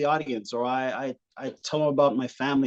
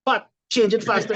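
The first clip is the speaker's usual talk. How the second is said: nagging mom; 7 semitones higher than usual. Retold or imitated. Mom nagging dad changing a tire, mildly raised voice. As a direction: hurry him along, nagging from the roadside. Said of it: "She's standing there watching, going".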